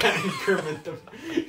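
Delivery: slow, laughing through words. Real, not acted. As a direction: crack up mid-word. Laughing over request.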